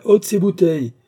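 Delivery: loud, high exclamation; exclaiming. Acted. Signoret ordering the bottles away from his guest, loud exclamation. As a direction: call out to clear the bottles now. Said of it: "s'écria Signoret".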